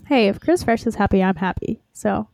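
ordinary delivery

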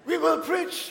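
high, imitated German accent. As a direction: proclaim it in a mock German accent. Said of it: "in his German accent".

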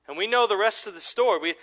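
normal talking voice

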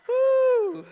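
loud high "woo"; big, high-pitched holler; relieved whoop. Acted. Relief after the card goes through.

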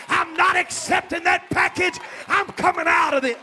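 shouted, driving sermon cadence; preacher urging the congregation to believe; full-force preaching. Acted. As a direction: hammer the message home. Preacher declares defiance.

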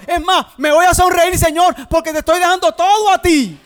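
loud, high, building cry to the Lord; shouted prayer. Pretend. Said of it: "usted le está diciendo al Señor". (oración gritada, cada vez más alta y fuerte)

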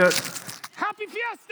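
high, slow festive cry; festive cheer. Acted. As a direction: sing out the greeting.

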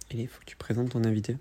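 quick whispered aside; whispering. Real, not acted. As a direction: whisper a prompt under your breath. Aside prompt to co-host.

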